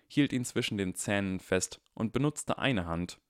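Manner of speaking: ordinary delivery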